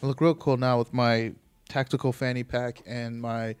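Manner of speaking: ordinary talk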